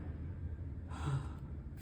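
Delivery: sharp shocked gasp of breath. Acted. Guards gasping in shock at the killing, a sharp breathless gasp. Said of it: "They all kind of gasp in shock".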